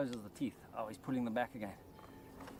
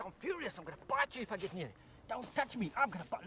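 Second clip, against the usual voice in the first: high, mock-furious snake voice; voicing the angry snake; 8 semitones higher than usual. Presenter voices the cornered viper threatening him, high and mock-furious. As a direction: put words in the viper's mouth, playfully outraged. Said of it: "Oh, he says".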